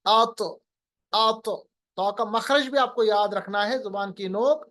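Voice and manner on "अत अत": अत with a heavy, bouncing त; qalqalah demo. Shown as a demonstration. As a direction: echo the त at the end of अत. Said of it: "आप सुकून के साथ इसको पढ़िए". (क़लक़ला के साथ सही अत)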